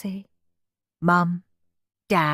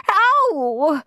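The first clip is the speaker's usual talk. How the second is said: high-pitched yelp of pain; drawn-out indignant ow; 9 semitones higher, 4 dB louder than usual. A narrator's reading. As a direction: yelp as if bonked on the head. Guest hit on head.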